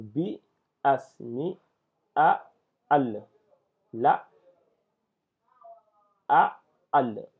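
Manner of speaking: syllables sounded out separately with pauses; detached syllables. Shown as a demonstration. Teacher reading detached letters to learners, isolated syllables. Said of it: "que nous devons voir en détaché".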